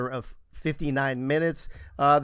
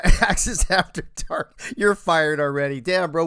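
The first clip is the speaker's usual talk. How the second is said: ordinary talk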